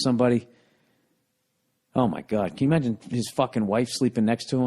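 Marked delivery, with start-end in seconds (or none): none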